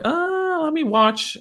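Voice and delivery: high, drawn-out "ah" of interest; enticing mock excitement. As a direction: lure a child over with feigned delight. Luring child back with phone.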